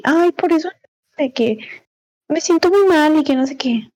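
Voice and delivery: high, whiny mock complaint; mocking a whiner. Mimicking attention seekers who complain to get sympathy, high and whiny. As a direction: parody a self-pitying attention seeker. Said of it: "por eso son de que".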